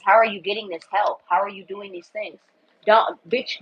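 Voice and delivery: ordinary talk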